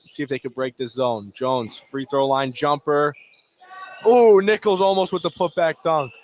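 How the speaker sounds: ordinary talk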